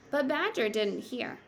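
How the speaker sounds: usual speaking voice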